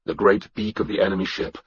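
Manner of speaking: usual speaking voice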